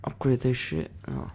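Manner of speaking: usual speaking voice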